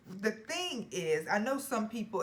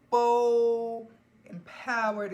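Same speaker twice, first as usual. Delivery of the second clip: bold; 4 semitones higher, 8 dB louder, much slower than usual. Real, not acted. Feeling bold from the perfume.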